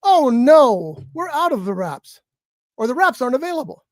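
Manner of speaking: mock automated apology, opening on a loud "Oh, no"; AI order-taker voice. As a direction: imitate a bot turning down an order. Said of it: "And the voice says".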